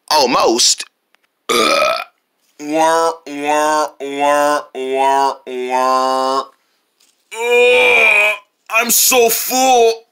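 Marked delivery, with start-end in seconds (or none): 2.6-6.4 mock crying. Fake crying over cookies. 7.3-10.0 stuffed groan. Acted. Groaning after eating too much.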